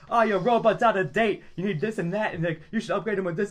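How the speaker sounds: hard-sell the upgrade; used car salesman. Retold or imitated. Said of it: "like the guy from Futurama, like, used car, goes like".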